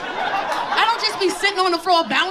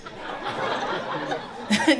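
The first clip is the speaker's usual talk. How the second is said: low breathy chuckle; 7 semitones lower, 5 dB quieter than usual. Real, not acted. Laugh without words.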